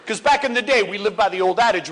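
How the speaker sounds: ordinary talk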